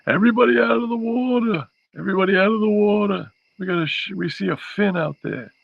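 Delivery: very high, loud lifeguard-style shouting; beach voice. Acted. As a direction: yell it like a lifeguard clearing the water. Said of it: "use your beach voice".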